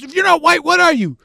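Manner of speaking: high-pitched demanding question; acted baffled challenge. Heckler who can't figure the preacher out, baffled challenge.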